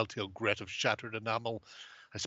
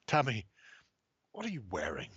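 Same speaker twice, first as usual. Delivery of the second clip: sleazy whisper. Acted.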